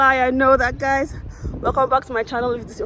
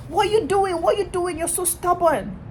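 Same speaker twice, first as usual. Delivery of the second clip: high exasperated instructor voice, dragging stubborn; mock exasperated yell; 9 semitones higher than usual. Driving instructor scolding his student, high exasperated yell. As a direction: throw up your hands at the learner. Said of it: "He was like".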